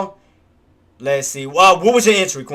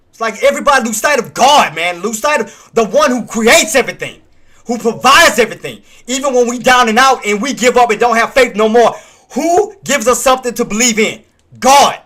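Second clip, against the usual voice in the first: booming, preacher-style proclamation, each line punched hard; loud preaching rant; 5 semitones higher, 5 dB louder than usual. Real, not acted. Streamer preaching to his viewers, loud and fervent. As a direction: preach it at full force about God. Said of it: "sorry about the rant".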